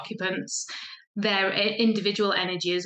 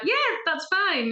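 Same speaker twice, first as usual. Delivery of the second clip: eager agreement; 9 semitones higher, slower than usual. Retold or imitated. Acting out an eager reply.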